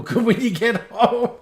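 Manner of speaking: breaking into laughter while talking; laughing. Real, not acted. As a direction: say it through a rising laugh. Joking about a very long flight.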